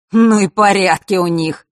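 hearty, chuckling-style exclamation; amused exclamation. A narrator's reading. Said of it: "хохотнул Марк".

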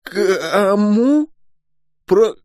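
very high, strangled squeak; high-pitched, spluttering astonishment; choked shock. A narrator's reading. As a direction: burst out aghast, then break off mid-word. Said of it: "Стражник булькнул и округлил глаза".

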